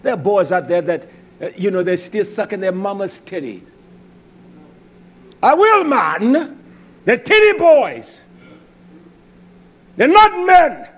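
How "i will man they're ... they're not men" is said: high, loud scornful cry; preaching shout. Acted. Preacher mocking weak men, shouting high. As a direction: hurl it out in contempt.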